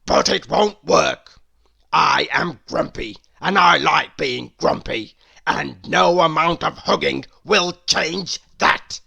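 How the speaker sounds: Mister Grumpy grumbling at Little Miss Hug. A narrator's reading. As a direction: grumble it like a cross old man.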